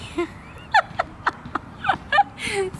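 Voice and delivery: high breathy giggle fit; high squealing laugh. Real, not acted. Laughing at her own outburst while telling viewers, high giggle. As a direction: laugh at your own whining.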